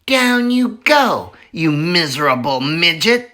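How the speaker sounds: bully him down the hole; bullying Bean. Acted. Bean bullying Bunce into the hole, taunting.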